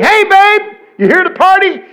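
high, loud come-on; party girl. Acted. Party girl accosting the preacher in an elevator, loud high squeal. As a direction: belt it out like a party girl hollering. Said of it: "that was her thing".

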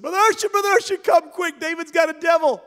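high, fast, panicked shout; frantic cry for help. Retold or imitated. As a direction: yell for help as the frantic sister. Sister bursts in for help.

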